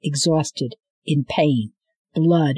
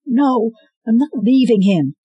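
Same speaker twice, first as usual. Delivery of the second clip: high, loud, clinging; desperate defiance over Wolf; desperate refusal; 8 semitones higher, 6 dB louder than usual. Acted. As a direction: refuse with everything you have. Scarlet refusing to leave Wolf.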